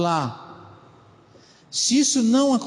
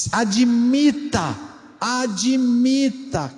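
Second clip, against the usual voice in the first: Admita drawn out, insistent; slow pleading emphasis; 3 semitones higher, much slower than usual. Shown as a demonstration. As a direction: repeat Admita slowly and urgently. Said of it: "Que difícil isso".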